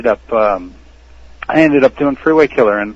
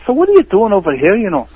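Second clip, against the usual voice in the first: put-on higher-pitched, lilting Irish accent; 9 semitones higher than usual. Actor in Irish character chatting to a casting director, Irish accent. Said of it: "I had this Irish accent going".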